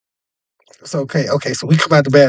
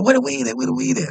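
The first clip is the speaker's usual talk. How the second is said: eager demand; 11 semitones higher than usual. Retold or imitated. Big man demanding weed.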